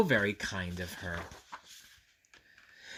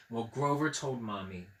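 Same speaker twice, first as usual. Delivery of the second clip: usual speaking voice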